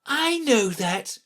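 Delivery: high, drawn-out eager answer. A narrator's reading. Alice answering a question she knows, eager. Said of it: "Alice cried eagerly".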